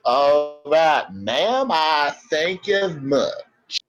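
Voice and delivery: slow drawling cowboy, very stretched out; a slow cowboy drawl, mildly done; cowboy accent. Acted. Robot helper thanking the madam in slow cowboy drawl. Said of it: "you must stop that accent".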